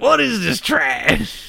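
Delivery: shrill mock wife, outraged; man doing a woman's voice. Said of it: "she sounds like an angry redneck".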